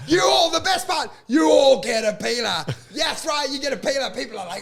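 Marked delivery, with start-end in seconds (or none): 0.1-2.6 high, loud giveaway shout; Oprah. Retold or imitated. Comic handing out free peelers, hammed-up host shout. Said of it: "like it's Oprah". 3.0-4.1 high, excited giveaway call; Oprah. Retold or imitated. Comic promising peelers to audience, mock host excitement. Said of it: "like it's Oprah".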